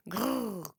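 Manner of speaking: tummy grumble. A narrator's reading. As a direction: rumble like a hungry belly. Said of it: "grumbled Autumn's tummy".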